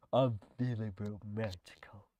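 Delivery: breathy flirty whisper. Acted. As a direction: whisper it like a seduction. Reads a flirty game line.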